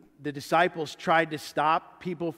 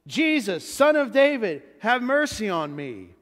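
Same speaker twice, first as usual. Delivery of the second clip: loud, high-pitched plea for mercy; acted cry for attention; 4 semitones higher, 6 dB louder than usual. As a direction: call out loudly over a crowd. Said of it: "cries out with a loud voice".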